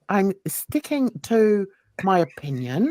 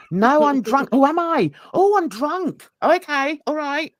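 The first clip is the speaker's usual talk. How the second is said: high, louder sarcastic surprise; mock astonishment; 6 semitones higher, 5 dB louder than usual. Accused of being drunk, sarcastic mock astonishment. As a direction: feign amazement at being told it.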